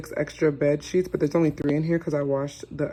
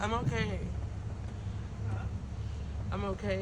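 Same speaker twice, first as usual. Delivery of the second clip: groggy; 5 semitones higher, 9 dB quieter than usual. Real, not acted. Groggy reassurance after surgery.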